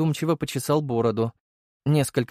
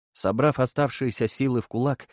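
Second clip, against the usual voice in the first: ordinary delivery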